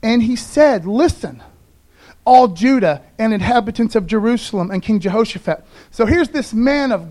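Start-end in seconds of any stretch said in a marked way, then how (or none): 0.0-5.6 louder, proclaiming Bible reading; scripture reading. Acted. Pastor reads the prophet's call to Judah, proclaiming voice. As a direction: declare the verse to the room.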